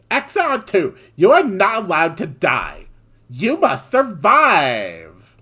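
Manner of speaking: put-on high, ditzy Valley Girl, loud and bubbly. Commander insisting her ally survives, in Valley Girl voice. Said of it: "my worst possible Valley Girl voice".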